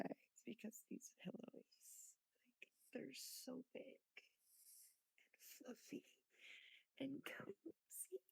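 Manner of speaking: soft breathy whisper. Real, not acted. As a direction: hush it like someone's asleep. Whispering about cozy pillows.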